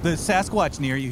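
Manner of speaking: ordinary delivery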